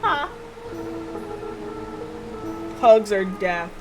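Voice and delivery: usual speaking voice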